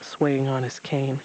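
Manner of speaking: ordinary delivery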